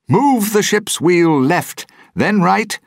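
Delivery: high, loud, slow barked commands; a captain shouting orders at the wheel; ordering. A narrator's reading. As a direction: bark the orders at the crew, slowly. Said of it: "he ordered".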